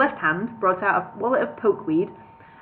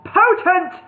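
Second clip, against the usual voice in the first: wizard voice; 9 semitones higher, 6 dB louder than usual. Acted.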